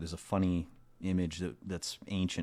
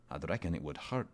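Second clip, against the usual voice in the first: ordinary delivery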